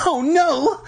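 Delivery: high-pitched horrified shriek. A narrator's reading. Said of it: "Lauren shrieked".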